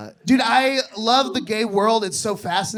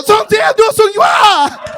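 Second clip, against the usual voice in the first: high, loud, slow mock Chinese shout; fake Mandarin gibberish; 12 semitones higher, 11 dB louder, slower than usual. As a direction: bellow fake Mandarin slowly. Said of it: "that's not real Mandarin".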